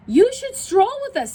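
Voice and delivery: lofty high invitation; high peacock call; high proud peacocks. Acted. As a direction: preen it like a peacock. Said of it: "said the peacocks".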